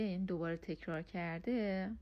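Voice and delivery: normal talking voice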